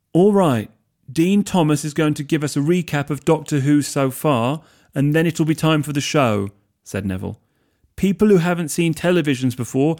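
rally the room like a host; host addressing room. Acted. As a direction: Neville announcing the recap to everyone, high and loud.

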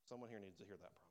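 ordinary talk, nothing marked

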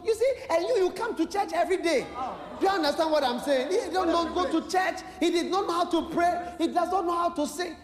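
high, loud preaching; preaching rebuke. Real, not acted. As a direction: drive it home to the congregation. Preacher rebukes congregation, shouting.

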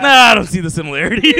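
loud, fast, laughing denial; mock denial through laughter. Real, not acted. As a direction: crack up mid-line. Laughing at own [name] joke.

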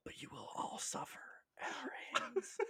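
eerie hushed threat, unvoiced; menacing whisper. Invader whispering fear tactics at a doomed world. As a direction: whisper doom to the whole planet. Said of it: "this dude coming over going".

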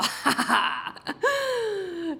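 a high, bursting laugh at the memory; real laugh. Real, not acted. Narrator laughing at her own dog story, real laugh.

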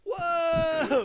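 very high mock gasp of wonder; crowd's amazed whoa. The congregation reacting to God knowing karate with a high amazed whoa. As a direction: gape like an astonished congregation.

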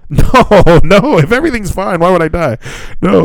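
high, laughing, loud-starting protest; incredulous denial. Real, not acted. Patient asked if he will die, incredulous. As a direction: protest the silly question, voice jumping up.

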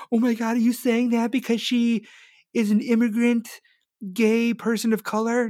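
high, whiny mock outrage; mocking critic. Host mimicking a critic who calls him bigoted, high whiny voice.

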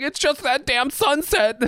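high, slow blubbering through laughter; hammed-up tearful whimper; mock crying. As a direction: sob the words like an overemotional movie guy. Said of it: "he just starts crying as he's talking".

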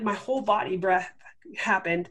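ordinary talk

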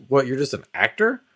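normal talking voice

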